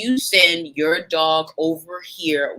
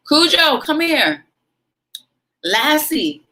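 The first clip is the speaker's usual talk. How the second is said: mock dog owner call; 10 semitones higher, 3 dB louder, slower than usual. Imitating owners calling their dog.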